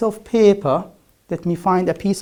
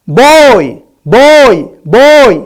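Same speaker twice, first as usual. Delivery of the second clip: "Boy!" yelled very loud and higher, with a voiced b; shouted boy; 6 semitones higher, 13 dB louder, much slower than usual. Shown as a demonstration. Teacher shouting boy at the paper to show it won't move. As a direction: belt out "Boy!" at full volume. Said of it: "Even if I shout".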